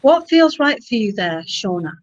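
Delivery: ordinary talk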